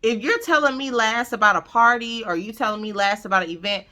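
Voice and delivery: ordinary talk, nothing marked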